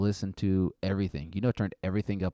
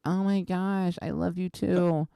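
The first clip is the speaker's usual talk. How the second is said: lightly voiced high girlfriend; 10 semitones higher, slower than usual. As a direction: answer sweetly as the girlfriend. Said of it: "And she's like".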